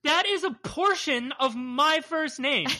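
playfully affronted, each word drawn out; mock offended. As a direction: defend your name with feigned hurt. Mock offence at name called boring.

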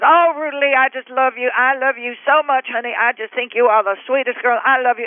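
high, breathless fan gush. Retold or imitated. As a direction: coo over a star like a smitten fan. Fan gushing over her, retold.

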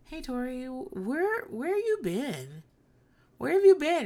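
mock-wounded, laughing through it; confused husband. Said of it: "her husband was like".

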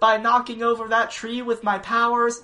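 put-on high, loud sorry kid; little boy voice. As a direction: apologise like an innocent child. Voicing Greg apologising.

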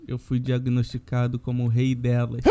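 low, monotone, deadpan announcement; put-on solemn voice, pitched low and level; mock-solemn statement. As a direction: deliver a grave official statement, tongue in cheek. Mock-solemn statement on his absence.

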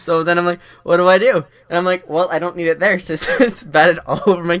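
rising excitement at the punchline; excited. Real, not acted.

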